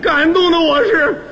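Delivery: high, drawn-out mock-tearful declamation; moved to tears. Recalling the gift of a casserole, hammed-up tearful gratitude. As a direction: overdo the gratitude, stretching every word high. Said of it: "感动的我是 热泪盈眶啊".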